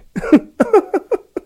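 very high, loud real laugh; burst of laughter. Real, not acted. Host laughing after telling off the hiker, loud high laugh. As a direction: crack up at your own story.

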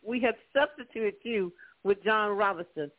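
ordinary talk, nothing marked

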